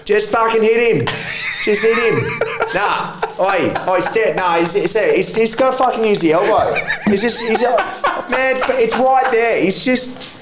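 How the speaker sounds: put-on a rowdy Aussie pub drunk heckling the fight; drunk pub punter. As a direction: play old Gaz shouting advice from his pub stool, laughing. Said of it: "sitting there going".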